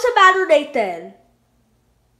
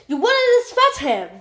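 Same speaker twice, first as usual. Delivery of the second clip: ordinary talk, nothing marked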